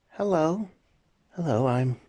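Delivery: a lightly voiced little girl, high and shy. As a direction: greet the new aunt like a small child. Little daughter greets the aunt.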